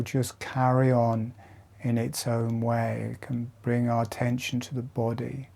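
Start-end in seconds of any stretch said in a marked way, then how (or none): 3.1-5.5 guided meditation. Acted. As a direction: lead them gently through each breath. Guides slow jaw breathing exercise.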